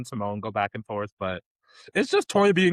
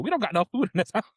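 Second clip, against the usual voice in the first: put-on high, fast kid complaint; kid complaining; 9 semitones higher, faster than usual. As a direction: whine it like a hungry kid. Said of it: "they were like".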